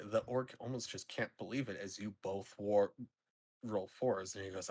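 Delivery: usual speaking voice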